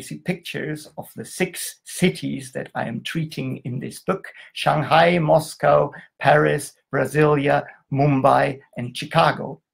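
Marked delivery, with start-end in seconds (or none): none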